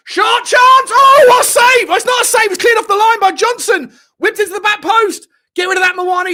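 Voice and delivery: high, full-volume goalmouth shouting; excited commentary shout. Real, not acted. Commentator calling a goal-line clearance to viewers, frantic shout. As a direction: shout the scramble as it happens.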